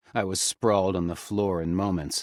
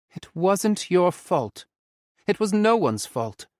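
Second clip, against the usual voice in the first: reassuring; 12 semitones higher, 6 dB louder than usual. A narrator's reading. Ollie reassuring Nikos it was no one's fault. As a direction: lean on the word no.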